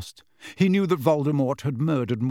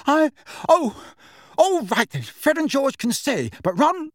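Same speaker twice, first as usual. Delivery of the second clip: very high, loud, flustered; flustered concession; 13 semitones higher, 5 dB louder than usual. A narrator's reading. Scarlet-faced mother grudgingly letting the twins stay, flustered. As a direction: give in reluctantly in a high, agitated voice.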